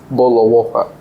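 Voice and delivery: everyday British accent, no T in "water"; old British accent. Shown as a demonstration. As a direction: swallow the Ts in "Boat of water", British-style. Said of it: "every British people was speaking like this".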